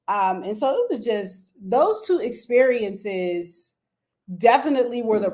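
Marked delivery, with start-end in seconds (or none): none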